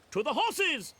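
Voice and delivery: high-pitched storybook rallying cry; D'Artagnan's call to ride. Acted. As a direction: cry it out without extra volume. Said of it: "D'Artagnan cried".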